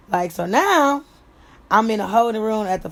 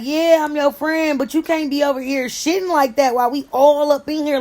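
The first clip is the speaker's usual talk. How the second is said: scolding; 5 semitones higher, 4 dB louder than usual. Retold or imitated. Scolding the cellmate for stinking up the cell. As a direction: tell her off loudly but kindly.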